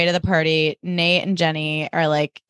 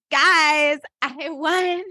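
giddy announcement; 9 semitones higher, much slower than usual. Retold or imitated. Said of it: "And Jenny's like".

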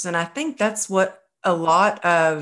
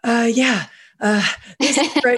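breathless after push-ups; out of breath; 5 semitones higher than usual. Retold or imitated. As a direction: puff it out winded. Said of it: "I was out of breath".

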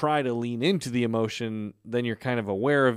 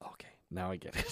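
near-whispered moment of understanding; hushed realization; 6 semitones lower, 12 dB quieter than usual. Retold or imitated. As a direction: let it sink in, very softly. Son's future phone call, imagined.

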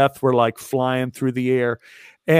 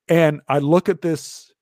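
ordinary talk, nothing marked

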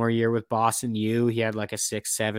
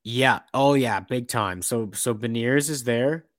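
usual speaking voice

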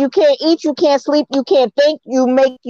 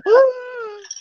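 high theatrical gasp; mock Karen gasp; 10 semitones higher, 4 dB quieter than usual. Imitating a Karen's shocked reaction, high gasp. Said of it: "And then you say".

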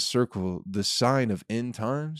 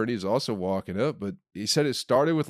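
ordinary talk, nothing marked

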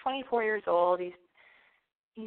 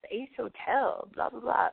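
mocking imitation; 3 semitones higher than usual. Mocking the jacket girl, lightly voiced. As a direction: sneer at her cool jacket plan. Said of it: "I just know she was like".

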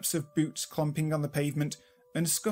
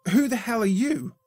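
higher, louder frightened yell; panicked shout; 7 semitones higher, 7 dB louder than usual. A narrator's reading. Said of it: "I shouted, panicked".

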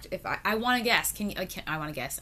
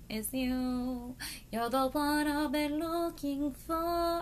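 higher, slowed, hammed-up swoon; mock romantic declaration; 7 semitones higher, 3 dB quieter, slower than usual. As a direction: play a dreamy movie lover, lightly. Made-up romantic declaration, [name] to Eileen.

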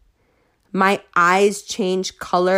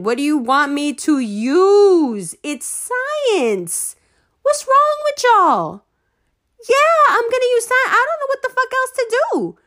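exasperated outburst; 11 semitones higher than usual. Real, not acted. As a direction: throw up your hands and wail it. Exasperated rant at critics.

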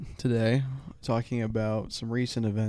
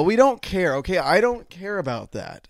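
louder and higher, fed up; exasperated shout; 7 semitones higher, 5 dB louder than usual. Retold or imitated. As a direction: blurt it out fed up. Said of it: "people are probably gonna be like".

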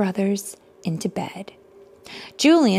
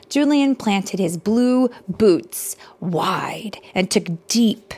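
ordinary delivery

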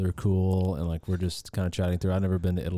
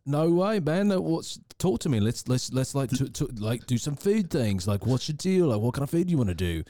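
higher-pitched, animated mock British bloke; English accent; 6 semitones higher, 4 dB louder than usual. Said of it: "do your best English accent".

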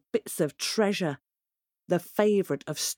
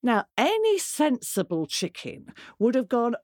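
ordinary delivery

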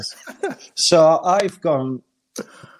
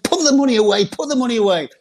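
urgent hush-up; 9 semitones higher, faster than usual. Retold or imitated. Dad urging money hidden.

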